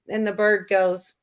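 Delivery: normal talking voice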